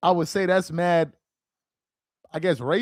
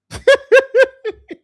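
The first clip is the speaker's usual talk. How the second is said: big high cackle at the comment, loud; loud burst of laughter; 9 dB louder than usual. Real, not acted. Streamer reading chat bursts into a loud, high laugh.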